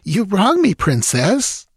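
high-pitched wounded protest; a touch of injured pride before the princess; deeply hurt. A narrator's reading. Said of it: "deeply hurt".

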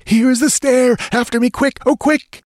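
high, urgent panting voice for the guide; panting Tekodl. A narrator's reading. Tekodl pants to the others, high and urgent. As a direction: gasp it out high, hurrying them on. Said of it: "Tekodl panted".

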